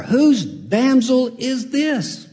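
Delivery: lightly voiced admiring Boaz; smitten Boaz, slow. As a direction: let Boaz marvel at her slowly. Preacher repeats Boaz's line.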